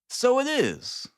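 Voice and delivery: so it is, rising high and slow in surprise; surprised. A narrator's reading. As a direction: spot the house and perk up, high. Holmes spots the house.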